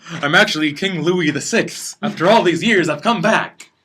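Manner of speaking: hammed-up announcement, rising in pitch; mock grand confession. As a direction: proclaim it as a joke reveal. Player acts a mock grand confession.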